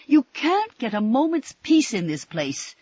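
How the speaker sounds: loud, high angry complaint; angrily. A narrator's reading. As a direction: snap it out in anger. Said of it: "said Missus Mitchell angrily".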